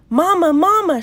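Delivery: high, loud call for Mama; excited child calling her mother. A narrator's reading. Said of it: "she called".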